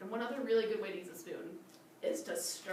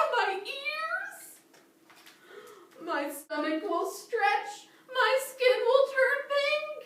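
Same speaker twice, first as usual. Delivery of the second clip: higher, louder, hammed-up crocodile, panicking over the seed; crocodile character voice; 9 semitones higher, 7 dB louder than usual. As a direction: play the silly crocodile big.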